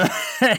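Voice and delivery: put-on high, crackly old man's laugh; malicious cackle. A narrator's reading. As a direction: cackle with spite. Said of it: "malignant, crackly laugh".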